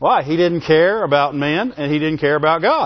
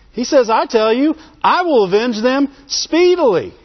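rising, higher preacher's climax; full-force preaching; 5 semitones higher than usual. Acted. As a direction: build it up from the pulpit.

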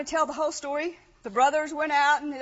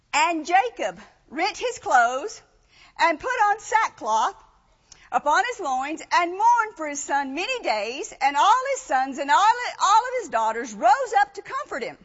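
scripture reading; 5 dB louder than usual. Acted. Reads scripture aloud.

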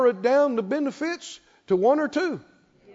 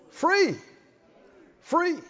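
exclaimed high; 7 semitones higher than usual. Acted. Preacher climaxing on truth.

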